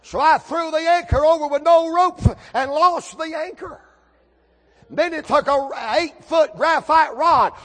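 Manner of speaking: high, loud storytelling holler; shouted pulpit storytelling, easing at the end; full-force preaching. Acted. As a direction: preach the climax of the tale. Preacher tells climax at full force.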